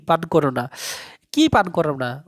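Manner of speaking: ordinary delivery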